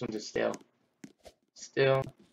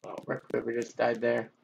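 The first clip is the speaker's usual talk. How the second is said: ordinary delivery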